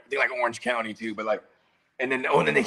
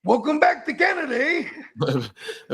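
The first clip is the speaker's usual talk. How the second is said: mock Canadian accent; 12 semitones higher, slower than usual. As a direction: tease in a put-on Canadian accent. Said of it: "making fun of them like that".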